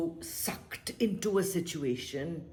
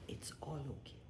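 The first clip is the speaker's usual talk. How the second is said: whispered; 3 semitones lower, 15 dB quieter, faster than usual. Shown as a demonstration. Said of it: "whispered then repeated aloud".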